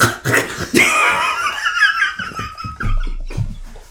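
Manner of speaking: high shrieking laughter; loud laughing fit. Real, not acted. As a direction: burst out laughing hard. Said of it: "What just happened?".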